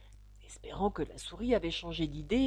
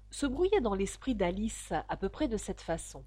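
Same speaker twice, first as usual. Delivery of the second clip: ordinary delivery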